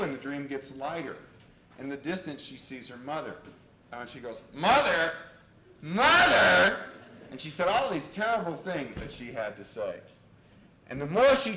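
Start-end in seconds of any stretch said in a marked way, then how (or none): 4.5-6.8 loud acted cry, rising on the repeat; call, then call higher and louder. Client calling her mother in a dream, loud.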